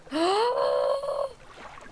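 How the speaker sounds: big drawn-in gasp; sharp gasp. Real, not acted. Player looks inside an old wreck, startled gasp. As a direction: gasp at a sudden find.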